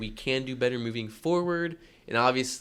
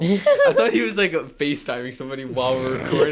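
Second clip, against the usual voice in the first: talking through laughter; laughing out the words; 7 dB louder than usual. Real, not acted.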